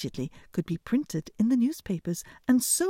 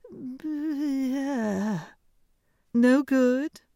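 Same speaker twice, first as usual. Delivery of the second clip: high, loud princess voice; haughty high princess; cartoon princess; 6 semitones higher, 3 dB louder than usual. A narrator's reading. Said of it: "said the princess".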